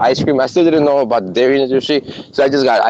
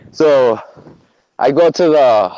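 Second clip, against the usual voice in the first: ordinary delivery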